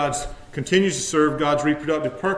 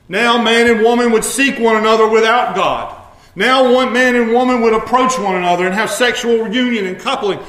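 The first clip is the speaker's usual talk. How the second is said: higher, louder preacher's cry against sin; preaching at full force; 7 semitones higher, 8 dB louder than usual. Acted. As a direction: build to a shout over sin. Preacher pressing the point loudly.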